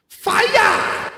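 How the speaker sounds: high, stretched-out yell on FIRE; hammed-up celebratory holler; drawn-out shout. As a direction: cry it out slowly like a hype man.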